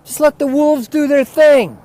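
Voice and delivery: loud furious rant, pitched high; yelling. Real, not acted. Ranting outdoors until his wife fears the neighbors, yelling. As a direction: bellow it at the whole world. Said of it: "If you start yelling like that".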